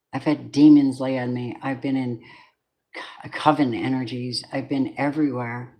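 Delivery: usual speaking voice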